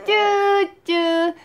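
high, loud cooing; sing-song calling. Real, not acted. Mother cooing the baby's pet name, high and loud.

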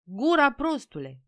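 high, loud first word, then dropping; surly rebuke. A narrator's reading. Stationmaster silencing a peasant, surly rebuke. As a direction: shut someone up grumpily. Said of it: "morocănos".

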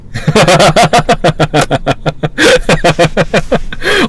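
bright real laugh; giggling at the joke; amused laugh. Real, not acted. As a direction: laugh at your own joke.

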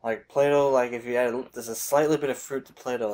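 glass held at mouth; speaking between sips; talking while sipping drink. Real, not acted. Seen 0: Left man talks smiling, glass raised at lips until lowered ~3.2s.

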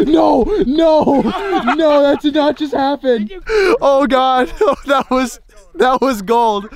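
high, loud disbelieving laughter; shocked laughter. Real, not acted. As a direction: laugh in shock at what just happened. Oh, God.

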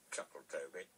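ordinary delivery, nothing marked

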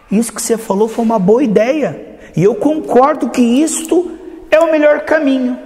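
rising, high-pitched approval; acted agreement. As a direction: agree grandly, pitch climbing. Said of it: "E ela diz assim".

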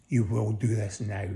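ordinary talk, nothing marked

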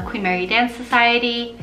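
usual speaking voice

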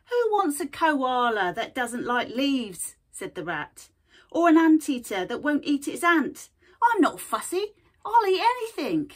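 high, loud, pushy shopkeeper rat; cheeky rat voice. Acted. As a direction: sell it like a brash little rat. Rat speaks in story.

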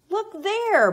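loud, high call; hammed-up storybook bellow; bellowing badger. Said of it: "Badger bellows".

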